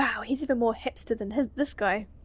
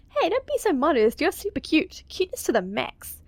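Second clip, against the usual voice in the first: gushing; 6 semitones higher than usual. Acted. Danny gushing.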